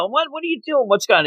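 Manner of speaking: high-pitched flustered retold questions; imitated confusion, pitched high; mock alarm. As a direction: play the startled bystander, pitch jumping up. Voicing startled boy confronting girl.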